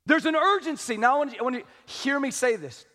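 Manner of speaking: usual speaking voice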